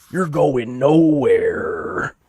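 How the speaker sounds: loud, drawn-out wrestler taunt; Bonesaw impression. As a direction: stretch it out like Hulk Hogan in the ring. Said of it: "It's kinda like a Hulk Hogan".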